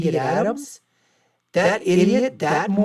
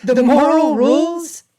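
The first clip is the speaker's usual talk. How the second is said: mocking shout; 7 semitones higher, 5 dB louder than usual. Real, not acted. Mocking the doctor's moral rules.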